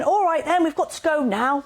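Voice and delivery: dismissive dad rushing off; dad ignoring the injury to leave, brisk and loud. Retold or imitated. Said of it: "my dad saying".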